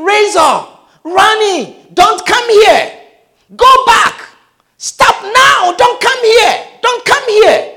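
high shouted warning; warning voices. Retold or imitated. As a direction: shout him back from the pit, urgent. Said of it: "someone was saying".

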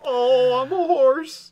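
very high, loud horse squeal; cartoon horse voice. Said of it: "not just play a clip of a horse".

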